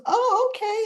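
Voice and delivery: high-pitched mock surprise; imitating startled realization, voice jumping up; taken aback. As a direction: act out an awkward "oh, I see". Visitor learns she is the wife.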